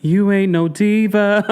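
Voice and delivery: high, drawn-out imitation of a retort; slow, high-pitched mocking voice; mock put-down. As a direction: stretch it out high as a teasing quote. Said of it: "She's like".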